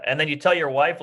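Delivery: ordinary talk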